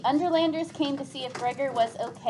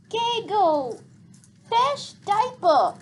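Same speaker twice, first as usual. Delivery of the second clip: put-on high, slow whiny little-kid voice; cranky toddler; 8 semitones higher, 6 dB louder, much slower than usual. Little sister demanding a diaper change, high cranky whine. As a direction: drag it out like a fussy toddler. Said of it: "said Boots crankily".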